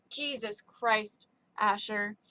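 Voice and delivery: usual speaking voice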